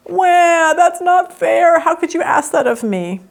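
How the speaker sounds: high, slow whiny protest; whiny teen. Retold or imitated. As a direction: whine like a sulky teenager. Kid's whiny protest quoted.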